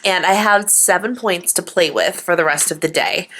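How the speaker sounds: ordinary talk, nothing marked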